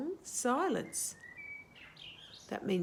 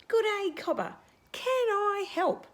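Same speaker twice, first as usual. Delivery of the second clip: croaky frog voice; 12 semitones higher, 5 dB louder than usual. Acted. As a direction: greet him as a cheerful tree frog. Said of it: "the croaky voice".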